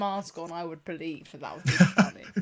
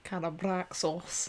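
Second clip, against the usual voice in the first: put-on slow, softer, drawn-out Scottish accent; 7 dB quieter, slower than usual. Quiz player echoing the villain's name, in a Scottish accent. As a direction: say it slowly with a Scottish burr. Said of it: "say that in a Scottish accent".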